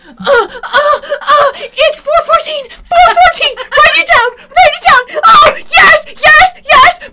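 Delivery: high squealing panic, laughing; frantic squealing voice. Pretend. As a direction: shriek it out, cracking with laughter. Mocking captor timing diary.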